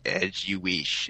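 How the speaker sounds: slow, soft attempt at a Kiwi accent; quiet, drawn-out New Zealand accent; Tamura Morrison. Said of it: "you gotta do the Tamura Morrison".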